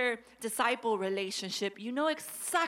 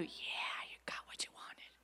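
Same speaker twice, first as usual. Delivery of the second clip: hushed, breathy gloating whisper; sly unvoiced whisper of satisfaction; whispered spirit; 13 dB quieter than usual. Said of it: "that spirit's telling you".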